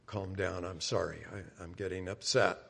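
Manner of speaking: soft, low, self-calming apology; acted subdued plea for a moment; calling a timeout. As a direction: back off quietly from an argument. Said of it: "just call a timeout and say".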